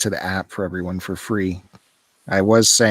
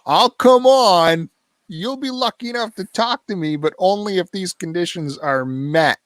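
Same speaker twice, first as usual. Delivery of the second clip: mocking imitation; 8 semitones higher than usual. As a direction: parrot the co-host's demands in a high, mocking voice. Mockingly restating co-host's terms for appearing.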